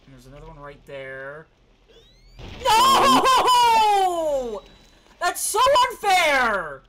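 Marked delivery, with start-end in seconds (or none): 2.6-6.8 half-laughing yell, slow on the end; frustrated shout. Real, not acted. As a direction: yell at the unfair game.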